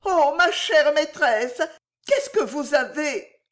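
high, theatrical alarm; distraught maid. A narrator's reading. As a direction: fuss in panic over the stricken mistress. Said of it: "s'écria la jeune personne en s'agenouillant d'un air égaré". (exclamation éperdue et aiguë de servante)

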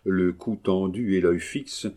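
plain reading voice

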